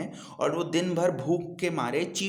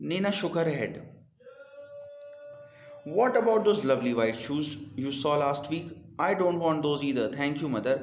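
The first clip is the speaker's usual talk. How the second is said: put-on slow, clear reading to children; slow deliberate read-aloud for young learners; storybook reading; 3 semitones higher, much slower than usual. A narrator's reading. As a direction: read the story slowly and carefully. Reads English story aloud.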